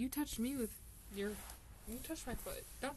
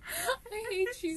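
high, slow, whiny I hate you; whiny mock hate; 10 semitones higher, slower than usual. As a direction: whine it like a fake sob. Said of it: "that sounded wrong".

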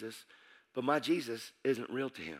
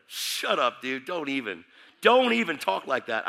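annoyed rebuff; 2 semitones higher, 7 dB louder than usual. Retold or imitated. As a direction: imitate bandmates shutting him down. Bandmates rebuff him in retold scene.

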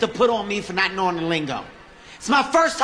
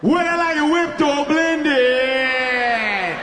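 very slow, higher, bewildered echo; dazed repeating; 5 semitones higher, much slower than usual. Retold or imitated. A baffled customer echoing the barista, very slow and higher. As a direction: parrot the question, stretched and lost. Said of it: "I just repeat shit".

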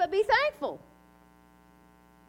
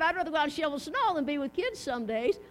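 ordinary delivery

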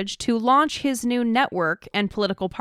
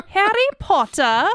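high, playful English accent, r's dropped; hammed-up British pronunciation; 10 semitones higher than usual. Shown as a demonstration. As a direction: put on a mock English accent, lilting upward. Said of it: "in an English accent sometimes".

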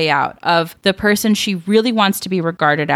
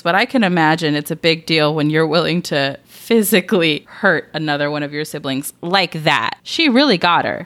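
usual speaking voice

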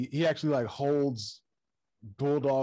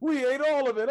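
high, loud, stretched out through laughter; laughing taunt; 12 semitones higher, 5 dB louder than usual. Retold or imitated. Fan retelling a wrestling skit, laughing hard. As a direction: giggle the taunt out. Imitating the Young Bucks, laughing.

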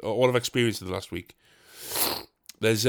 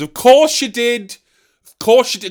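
much higher, louder mock-weary of course; sarcastic exasperation; 10 semitones higher, 9 dB louder than usual. Real, not acted. Comedian moaning they got stuck with her, sarcastic. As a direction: roll your eyes at the luck.

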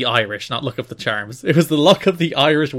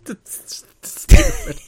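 squeaky laugh-line; 7 dB louder, much slower than usual. Real, not acted. Laughing squeal at dumb match.

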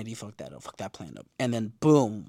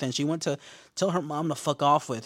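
ordinary talk

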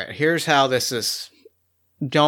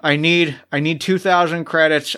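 usual speaking voice

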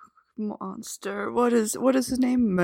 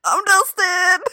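high, slow, wailing catchphrase; mock Dustin sign-off; 11 semitones higher, 6 dB louder, slower than usual. Co-host wailing the Dustin catchphrase, high and slow. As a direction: stretch it out like a fake sob. Co-host repeats the running joke.

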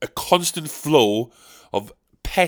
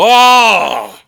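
jokey grumble; 7 semitones higher, 7 dB louder than usual. Retold or imitated. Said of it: "And you go".

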